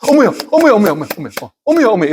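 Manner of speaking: high, startled exclamations; acted astonishment. Preacher mimicking listeners hearing surprising news, startled. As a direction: keep gasping out mock shock. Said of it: "놀라운 이야기를 들으면 여러분들 어떻게 반응하세요".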